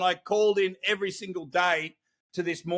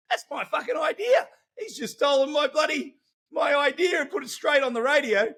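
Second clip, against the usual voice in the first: indignant outrage; 8 semitones higher than usual. Retold or imitated. Inner outrage at stolen idea.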